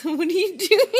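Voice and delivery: laughing through the question; giggling as you ask. Real, not acted. Parent laughs at toddler's face.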